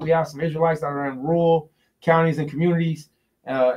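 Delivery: ordinary talk, nothing marked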